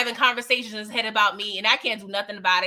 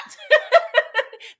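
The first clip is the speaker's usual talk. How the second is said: real, high cackling laugh; high burst of laughter; 13 semitones higher, 6 dB louder than usual. Real, not acted. Host laughs at Marvin's second strike, loud high cackle. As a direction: break up laughing loudly.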